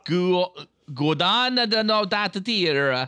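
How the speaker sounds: put-on Guadana said in a hammy Italian accent; sing-song mock Italian accent, slow. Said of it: "I did it in an Italian accent".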